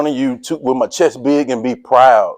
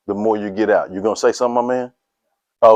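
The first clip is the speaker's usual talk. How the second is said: ordinary talk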